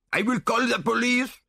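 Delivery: put-on very high French-accented threat; outraged Frenchman. A narrator's reading. Caricatured Frenchman threatens the man.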